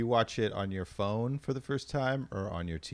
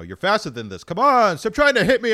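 loud, high taunting shout; goading shout; 15 dB louder than usual. Retold or imitated. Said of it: "he's probably saying something like".

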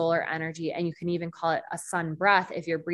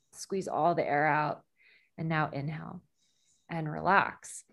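guided breathwork. Acted. Leads a breathing exercise.

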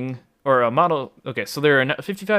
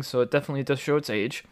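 normal talking voice

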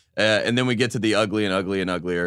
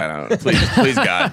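mock desperate pleading; 2 semitones higher, slower than usual. Mock pleading not to be called.

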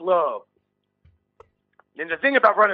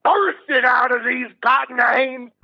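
hammed-up fervor; 7 semitones higher than usual. Lewd preacher-like outburst.

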